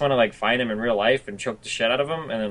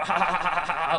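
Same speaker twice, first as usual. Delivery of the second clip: high, drawn-out fake gloating cackle; mock troll laugh; 6 semitones higher than usual. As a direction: imitate a troll laughing in your face.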